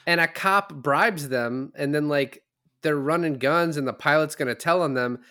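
excited disbelief; retold excitement. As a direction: blurt it out like a fresh discovery. Retold surprise reading IMDB.

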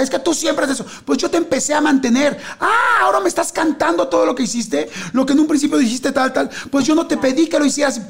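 high, agitated reproaches, rising to a shout; acting out an angry lovers' fight; heated couple argument. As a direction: argue like a partner who's fed up. Acted couple quarrel in fable.

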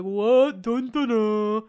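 slow, mildly dopey imitation of a husband; lightly mock his cluelessness, drawing it out; a hint of a dim-witted husband; mocking clueless husband. Mocking Brett's clueless reply.

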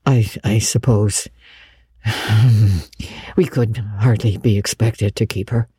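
low, halting concession with a sigh; uncomfortable. A narrator's reading. Matthew cornered by Marilla, uncomfortable. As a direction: give in haltingly, sighing midway. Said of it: "uncomfortably driven into a corner".

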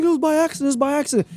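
mock a frightened girlfriend insisting it was an accident; scared pleading. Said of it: "she was scared".

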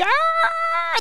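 very high shriek; piercing mock dog shriek; screaming Shih Tzu. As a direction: imitate the dog's scream. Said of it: "The screaming Shih Tzu".

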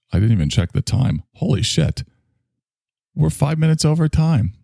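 normal talking voice

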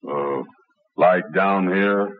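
deep, slow trial voice; low voice test. Acted. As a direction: drop the voice way down, slow. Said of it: "Get on lower".

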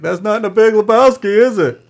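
high, loud playful mock question; mock silly question. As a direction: throw it out loud and high as a joke. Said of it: "he was like".

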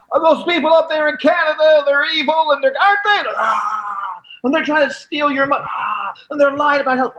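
high, loud, hammed-up pundit voice; mocking fearmonger anchor; mock newsman. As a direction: whip up the crowd like a fake reporter. Posing as Fox reporter to crowd.

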